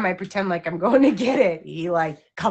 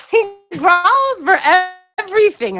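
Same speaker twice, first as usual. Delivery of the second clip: excited; 9 semitones higher, 10 dB louder than usual. Real, not acted. Amused complaint about dog.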